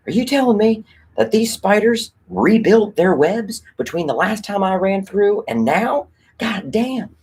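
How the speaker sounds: high exasperated disbelief; squeaky, indignant rant; annoyed character. Retold or imitated. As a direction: whine at the spiders in a high put-upon voice. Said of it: "he's so annoyed".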